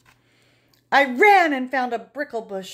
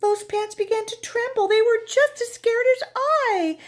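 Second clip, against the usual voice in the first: higher, trembling-scared tone; a touch of fright; 12 semitones higher than usual. Acted. Narrator seeing pants tremble, mildly frightened. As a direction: tell it wide-eyed.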